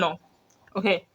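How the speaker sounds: ordinary talk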